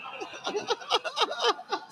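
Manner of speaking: high, sudden burst of laughter. Real, not acted. Laughs at fire joke comment.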